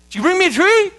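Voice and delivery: put-on high, loud child asking; eager kid. A son greets his father at church, high and eager. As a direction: ask it like a little boy bursting with hope. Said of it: "time I see him here in church".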